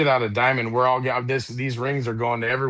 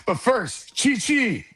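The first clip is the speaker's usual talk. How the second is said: punchline voice; 5 semitones higher, slower than usual. Acted. Punchline voice in a joke.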